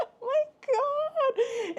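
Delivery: high, slow laugh into happy tears; laugh-crying. Real, not acted. Vlogger overcome by the cute pickle, laugh-crying. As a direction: laugh and cry at once.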